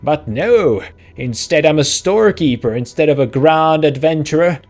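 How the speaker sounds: loud, hammed-up marital outburst; shouting match. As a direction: rant back indignantly in a comic character voice. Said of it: "degenerate into a shouting match".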